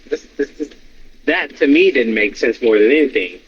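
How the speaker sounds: usual speaking voice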